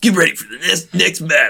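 light arcade announcer impression; fighting game announcer. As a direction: hype it up a little like an arcade announcer. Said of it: "the announcer voice".

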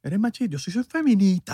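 high, put-on indignant voice; high-pitched mock indignation; mock feminist. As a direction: parody an offended activist. Parodies an offended feminist.

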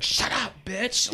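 squeaky high retort; high mock snap. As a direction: fire back in a high voice. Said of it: "And I'll be like".

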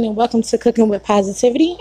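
ordinary talk

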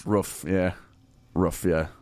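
roof with a short vowel, like woof; regional roof. Shown as a demonstration. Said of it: "rhymes with the sound the dog makes".

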